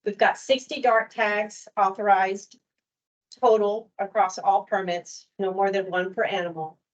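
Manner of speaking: ordinary talk, nothing marked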